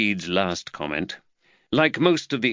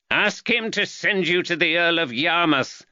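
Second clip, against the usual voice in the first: calling after someone leaving, raised pitch; shouted after him; 9 semitones higher than usual. A narrator's reading. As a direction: call it across the room to his back. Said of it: "Grey shouted after him".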